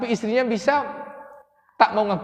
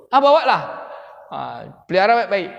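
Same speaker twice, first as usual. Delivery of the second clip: loud ready yes; 4 dB louder than usual. Acted. Royals grant the mother's request.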